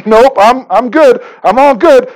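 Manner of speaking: mock-cheerful I'm fine; breezy denial. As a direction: brush it off like nothing's wrong. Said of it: "when I pretend like there's no sin here".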